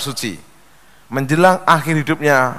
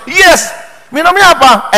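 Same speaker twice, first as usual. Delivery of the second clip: put-on delighted girlfriend; 11 semitones higher, 5 dB louder than usual.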